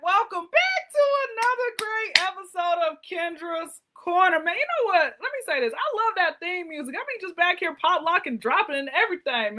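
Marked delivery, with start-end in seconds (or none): 0.0-4.4 show-host opening. Acted. Host welcoming viewers back to another episode, show-host hype.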